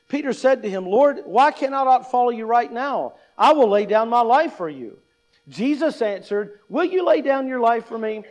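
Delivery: a lightly heightened Bible reading; scripture reading. Acted. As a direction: read the passage to the congregation. Reads scripture aloud, louder.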